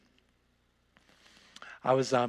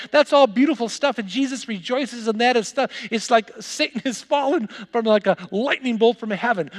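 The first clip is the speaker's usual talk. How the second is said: fervent exhortation; 7 semitones higher, 8 dB louder than usual. Acted. Pastor urging the congregation to find their motivation, loud and high. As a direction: drive the call home to the pews.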